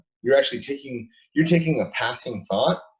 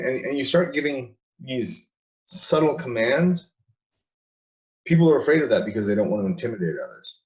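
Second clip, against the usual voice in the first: usual speaking voice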